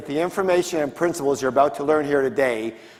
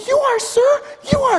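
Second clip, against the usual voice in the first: very high, loud put-on voice; submissive snake; 4 dB louder than usual. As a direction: squeal a deferential answer as a cowed snake.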